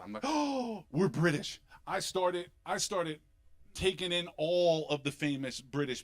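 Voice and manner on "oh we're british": lightly acted gasp of grand realization; mock-serious discovery. Man telling his wife of his DNA result, mock awe. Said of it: "I took it very seriously".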